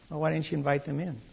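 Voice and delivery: normal talking voice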